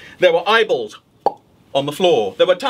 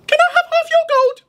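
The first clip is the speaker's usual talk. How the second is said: very high, louder squeaky rabbit; rabbit asking; 5 dB louder, faster than usual. Acted. Rabbit at the witch's door, squeaky asking. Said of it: "the rabbit said".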